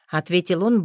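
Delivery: ordinary delivery, nothing marked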